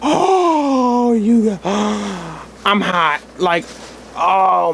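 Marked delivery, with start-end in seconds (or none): none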